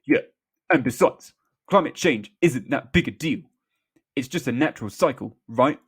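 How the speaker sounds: loud, blustering politician impression; hammed-up bluster, punching the words; Boris. A narrator's reading. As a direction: chime in dismissively. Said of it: "Boris, chiming in".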